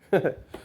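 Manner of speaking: short high mocking laugh. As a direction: let out a quick smug laugh. Laugh before countdown.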